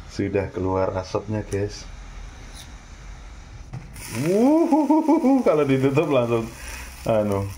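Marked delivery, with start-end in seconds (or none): 4.2-5.4 surprised whoop. Real, not acted. Steam bursts from pressure cooker.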